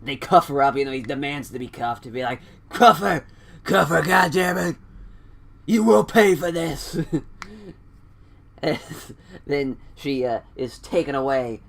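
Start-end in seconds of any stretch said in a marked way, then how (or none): 2.7-7.0 loud, high, outraged wrestler voice; hammed-up shouted demand. Demanding that security cuff her, mock furious shout. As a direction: shout orders in mock fury.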